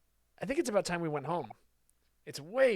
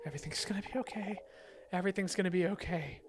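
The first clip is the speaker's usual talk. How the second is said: ordinary delivery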